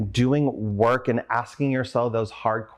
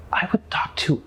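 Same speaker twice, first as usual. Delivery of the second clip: whispered; 6 semitones higher than usual. Real, not acted. Confessing to friend, emotional.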